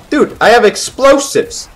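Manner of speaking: high, loud, punchy battle hype; hyped-up excitement. Real, not acted. Gamer with explosives going for a kill, hyped shouting. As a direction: build loudly to a huge high boom.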